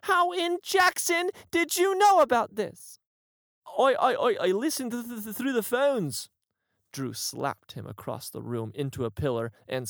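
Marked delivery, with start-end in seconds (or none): 0.0-3.0 much higher, much louder shriek; furious shout. Acted. Drew screams at Bell over the leak. 3.8-6.3 higher, louder stuttering panic; terrified stammer. Acted. Bell stutters in fear before furious Drew.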